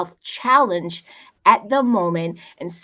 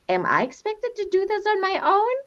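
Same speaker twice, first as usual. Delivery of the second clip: inner doubt; 11 semitones higher than usual. Retold or imitated. A single parent asking herself, lightly voiced. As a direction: voice the lonely doubt of someone left alone.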